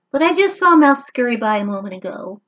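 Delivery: fast chatter, a little fly; fly quickly pointing the way; lightly voiced fly. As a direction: rattle it off quickly. Fly answers Max.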